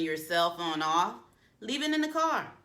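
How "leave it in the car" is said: high insistent. Shown as a demonstration. Coach tells phone-lovers to leave it, high insistent. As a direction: leave it in the car, high and pointed. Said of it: "if you're someone who do not believe".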